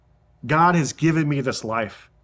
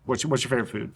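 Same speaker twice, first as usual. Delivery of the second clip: much softer, lower, as to someone right beside you; quiet close-up question; 3 semitones lower, 6 dB quieter, much faster than usual. Shown as a demonstration. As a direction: ask gently up close. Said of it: "Just come close".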